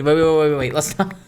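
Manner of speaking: ordinary talk, nothing marked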